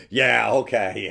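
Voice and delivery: loud, drawn-out mock agreement; imitating dismissive woman. Jill brushing off his confession, dismissive. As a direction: stretch it out in sarcastic disbelief.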